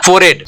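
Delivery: "फोरहेड" as "for-ed", the h dropped; correct contracted pronunciation. Shown as a demonstration. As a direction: run "फोरहेड" together without an h. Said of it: "ये क्या कहलाएगा". ("फोरहेड" को फ़ोरेड बोलें, ह silent)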